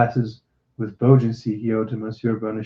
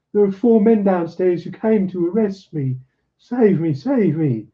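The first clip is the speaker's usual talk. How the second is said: pleading; 8 semitones higher than usual. A narrator's reading. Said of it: "he wailed".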